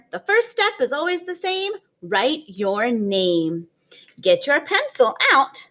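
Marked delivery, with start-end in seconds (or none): 2.0-3.7 slow, deliberate teacher voice; slow and clear. Shown as a demonstration. Teacher to kindergartners, slow and clear. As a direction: spell it out slowly for small children. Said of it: "I want you to write your name".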